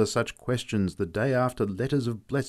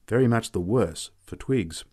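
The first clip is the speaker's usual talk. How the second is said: ordinary delivery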